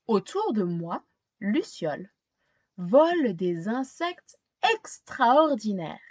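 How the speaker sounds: put-on gentle read-aloud narration for a young child; storybook reading. A narrator's reading. Teacher reading a picture book to a child, storytelling voice. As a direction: tell it like a bedtime picture book.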